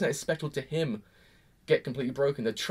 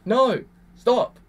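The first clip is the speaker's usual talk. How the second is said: higher, louder pleading no, stop; pleading cry; 8 semitones higher, 7 dB louder, much slower than usual. Retold or imitated. Pleading at the screen for Subaru to stop. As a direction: beg the character to stop. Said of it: "I'm sitting there going".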